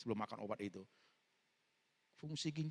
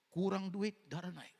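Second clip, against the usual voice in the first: punchline; 6 semitones higher, 3 dB louder, slower than usual. Acted. Punchline in list of causes.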